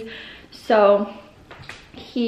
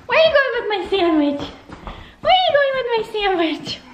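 playful mock outrage; 10 semitones higher than usual. Real, not acted. Toddler walks off with sandwich.

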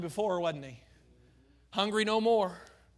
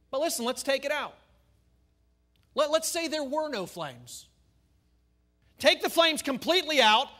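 preaching at full force; 7 semitones higher, 8 dB louder than usual. Acted. Preacher rebuts the liberals loudly.

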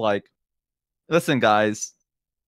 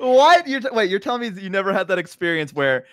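ordinary delivery

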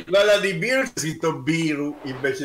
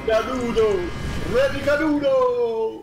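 mock cheer; 7 semitones higher than usual. Mocking a fallen player.

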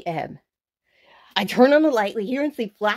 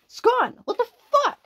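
high, loud disbelieving cry; real shock at the missing radio; shocked outburst; 4 dB louder than usual. Real, not acted. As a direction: yelp in sudden disbelief.

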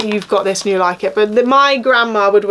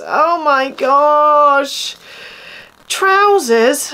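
drawn-out high dismay; dismayed groan; 4 semitones higher, much slower than usual. Real, not acted. Unboxing clothes, sees trousers, drawn-out high dismay. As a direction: stretch the oh in horror.

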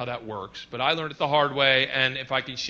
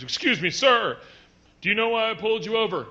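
higher, louder polite cop; 8 semitones higher, 6 dB louder than usual. Retold or imitated. Cop asking driver at window, mock polite. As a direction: ask it like a stern officer. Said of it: "he goes".

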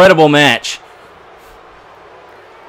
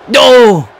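loud high cry of protest; despairing shout; 12 semitones higher, 6 dB louder than usual. Real, not acted. Gamer yelling at the screen as he loses, loud and high. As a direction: yell it as the match slips away. Opponent wins the pin.

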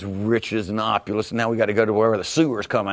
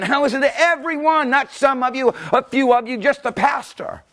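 full-force preaching; 13 semitones higher, 3 dB louder than usual. Real, not acted. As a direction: preach it out with fervor. Preaching peak.

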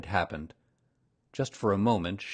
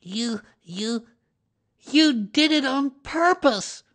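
high, halting accusation, growing louder; splutter, then accuse slowly up high; small animal sputtering in protest; 7 dB louder, slower than usual. A narrator's reading. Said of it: "spluttered Piglet".

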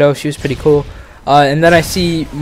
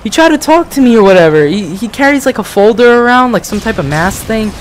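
higher put-on mom voice; imitating mom; 7 semitones higher than usual. Son imitating his mom telling about the creepy guy, pitched up. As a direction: complain like an upset mother. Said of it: "And then she's like".

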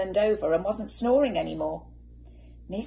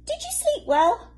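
bright cheery question; 7 dB louder than usual. A narrator's reading. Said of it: "she said".

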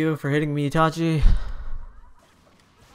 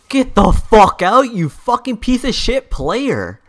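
raised, higher-pitched gamer rage; angry outburst; 7 semitones higher, 5 dB louder than usual. Real, not acted. Player cursing a spamming opponent, angry outburst. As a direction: lash out at the other player.

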